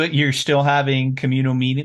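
ordinary delivery

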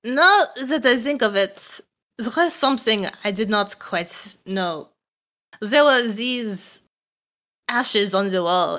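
put-on puzzled recollection in a French accent; French-accented character. Telling of ashes on the wall, in a French accent. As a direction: think it through, French-accented.

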